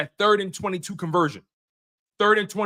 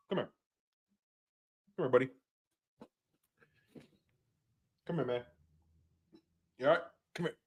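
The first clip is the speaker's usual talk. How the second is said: soft dog coaxing; 6 semitones lower, 11 dB quieter, much faster than usual. Real, not acted. Talking softly to the dog.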